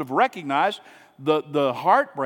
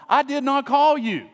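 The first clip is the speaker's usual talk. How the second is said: higher, louder, fed-up denial; irritated old priest; 9 semitones higher, 5 dB louder than usual. Retold or imitated. Eli to the boy a second time, fed up. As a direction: snap back that you never called. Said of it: "he runs into Eli".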